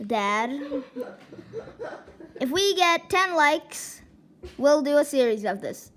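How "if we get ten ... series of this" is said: loud announcement. Real, not acted. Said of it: "announcing like goals loudly".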